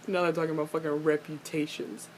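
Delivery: ordinary delivery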